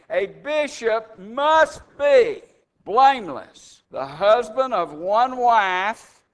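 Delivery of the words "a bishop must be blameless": "must" drawn out slowly and hit with a falling tune; slow down and lean hard on "must"; emphatic must. Shown as a demonstration. Preacher drives the rule home to the congregation, slow and emphatic on "must". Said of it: "and it's emphatic".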